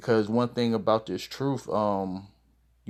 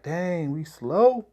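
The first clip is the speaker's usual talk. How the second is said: higher, slow incredulous question; amused disbelief; 6 semitones higher, much slower than usual. Retold or imitated. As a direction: retell it as a drawn-out double take. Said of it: "I laughed. I was like".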